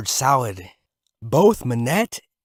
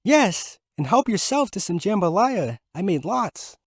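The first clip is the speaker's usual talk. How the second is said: lightly higher hostess voice; wife voice; 7 semitones higher than usual. A narrator's reading. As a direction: offer food kindly. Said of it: "offered Manette".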